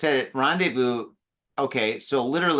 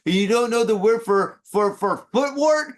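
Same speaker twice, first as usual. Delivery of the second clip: mocking foreigner; 8 semitones higher, 3 dB louder than usual. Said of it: "And then they're like".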